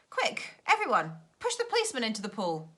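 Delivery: quick, rising urgent shout; a child urging his friends on; urgent call to act. Acted. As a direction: hurry them along, loud and fast. Joe urges everyone to push.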